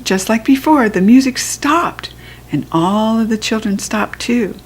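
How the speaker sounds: ordinary delivery, nothing marked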